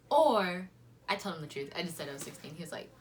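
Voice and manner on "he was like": ordinary talk, nothing marked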